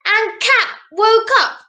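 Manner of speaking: gentle storybook narration; reading to children. A narrator's reading. Reads picture book to children.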